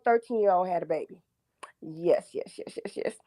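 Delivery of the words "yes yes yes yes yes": whispered repeated yes; whispering. Real, not acted. Caller whispers emphatic confirmation.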